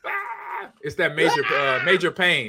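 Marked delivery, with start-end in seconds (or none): 0.0-0.7 high, softer echoed scream; mock child's wail. Host echoes co-host's crying child, high pretend wail. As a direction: echo a kid's cry, thin and very high.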